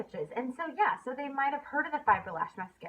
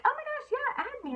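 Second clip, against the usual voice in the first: a much higher, squealing yes; eager customer. Retold or imitated. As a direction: imitate the delighted customer jumping at it. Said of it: "they're like".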